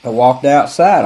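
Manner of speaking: usual speaking voice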